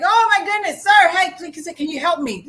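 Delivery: high, loud acted plea; urgent calling out. Trapped man to a passing therapist, urgent calling out. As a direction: hail a passerby, hammed-up and desperate.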